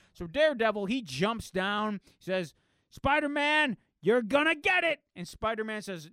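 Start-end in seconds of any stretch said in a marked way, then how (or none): 2.9-5.0 threatening shout. Acted. Daredevil threatens Spider-Man.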